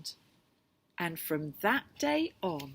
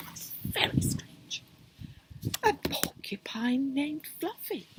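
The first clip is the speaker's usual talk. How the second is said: whispered aside; 6 semitones higher than usual. Real, not acted. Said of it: "reader aside".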